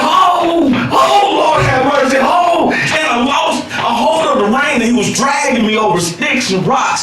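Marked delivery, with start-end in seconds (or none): 0.0-2.7 frantic shouting to heaven; panicked cries. Retold or imitated. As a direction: cry out while being dragged. Dragged by a bolting horse.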